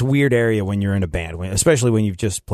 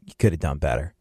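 low, understated disappointment; acted low-key letdown; disapproving verdict; 8 semitones lower, 4 dB quieter than usual. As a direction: drop it low in mild disapproval. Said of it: "fine means, like".

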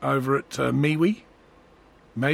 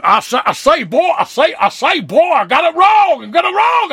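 Foghorn Leghorn; 11 semitones higher, 5 dB louder than usual. Pretend. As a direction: bellow it like a southern cartoon rooster. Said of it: "Foghorn Leghorn outfit".

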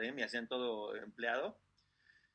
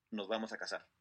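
low, soft, matter-of-fact statement; retold promise, quietly settled with a falling end; 4 semitones lower, 5 dB quieter than usual. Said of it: "y le dice".

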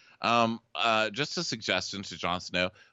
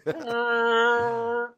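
long, very high wail; imitated distant noise; 13 semitones higher, 5 dB louder than usual. Podcaster imitating what is heard from the roof, high wail. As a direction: hold a high sustained mock call.

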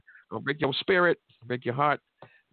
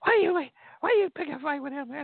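imitating wife scolding. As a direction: play the wife scolding her husband for picking a fight. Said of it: "she gonna blame him later".

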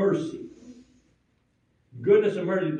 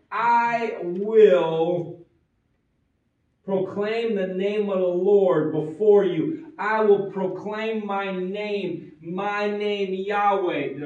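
slow proclamation; 2 semitones higher, 4 dB louder, slower than usual. Acted. Voicing God proclaiming his name.

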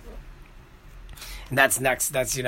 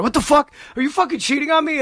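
much higher, hammed-up shocked accusation; mock jealous outrage; 12 semitones higher than usual. Seeing Dwayne on Brenda's phone, hammed-up shocked outrage. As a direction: fake a jealous boyfriend's alarm.

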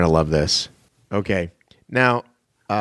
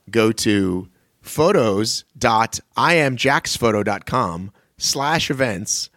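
slow and deliberate; much slower than usual. Real, not acted. Slow spelled-out web address.

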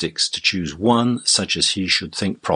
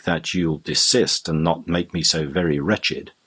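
ordinary delivery

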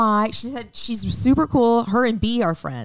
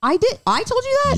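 surprised. Real, not acted. Surprised at being told.

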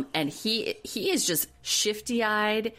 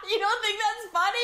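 much higher, put-on outrage; mock incredulous. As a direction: tease him as if shocked. Teasing mock-incredulous question.

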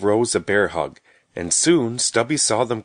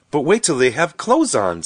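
happily raised, lilting voice; happy; 6 semitones higher, 4 dB louder than usual. A narrator's reading. Rose looking forward to dressing the apple dolls, happily. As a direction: say it higher, with happy anticipation. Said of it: "said Rose happily".

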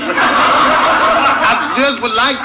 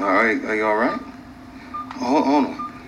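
worried hush; 11 semitones lower, 8 dB quieter than usual. Acted. Dave worried at bedside.

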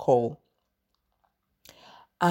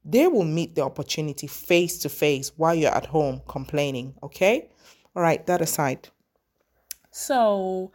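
usual speaking voice

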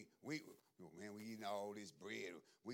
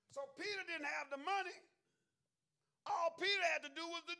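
full-force preaching; 13 semitones higher, 8 dB louder than usual. Acted. Preacher rousing the saints about Peter's fish, loud and high. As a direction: drive the point home to the congregation.

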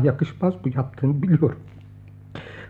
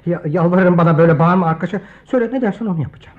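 louder, fast, stammered begging; frantic pleading; 5 dB louder, faster than usual. Acted. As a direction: rush the plea in panic. Pleading with angry friend. (telaşlı, hızlı yalvarış)

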